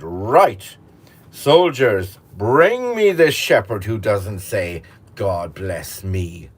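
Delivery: loud, commanding royal bark; king's command. Acted. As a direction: order the soldiers like an angry king. Furious king commands soldiers.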